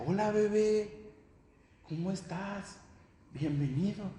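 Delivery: a lightly voiced mother cooing to her baby; mother greeting newborn. Wife greets the newborn in the operating room, tenderly. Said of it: "Le decía".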